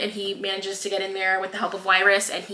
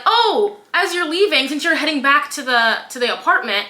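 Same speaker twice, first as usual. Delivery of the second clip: higher, louder, falsely sweet sneer; a hammed-up villain voice, higher and sugary; mock sweet villain; 6 semitones higher, 4 dB louder than usual. President Snow sweetly taunting Haymitch before he leaves, higher mock villain voice.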